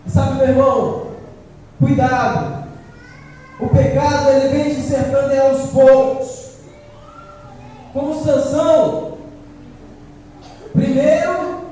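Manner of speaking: loud, forceful warning from the pulpit; fiery preaching. Acted. Preacher warning his brothers about sin, loud and forceful. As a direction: preach it hard at the congregation.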